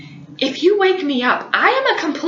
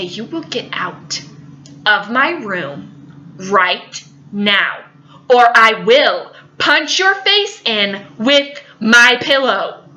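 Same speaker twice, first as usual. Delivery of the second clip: slow menacing threat; 5 semitones lower, much slower than usual. Retold or imitated. Sleepy sister makes slow threat.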